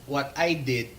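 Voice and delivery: ordinary delivery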